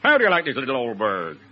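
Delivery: very slow, drawn-out mock-American drawl; low, stretched American accent; Bob Hope. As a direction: drawl it out like a comic American westerner. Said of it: "my impersonation of Bob Hope talking English".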